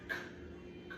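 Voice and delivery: "K" as a soft unvoiced k sound; a voiceless, breathy "K"; whispered k sound. Shown as a demonstration. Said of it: "What's the first sound".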